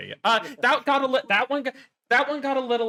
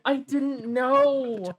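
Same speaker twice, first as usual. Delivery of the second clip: mock dismay, slower; 6 semitones higher, much slower than usual. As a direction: let it trail off even slower. Mock shock, repeated.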